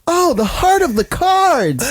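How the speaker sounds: high, slow dramatic anime hero. Retold or imitated. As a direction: overplay the heroic line. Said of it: "he's like".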